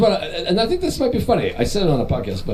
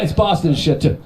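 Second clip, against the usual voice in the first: usual speaking voice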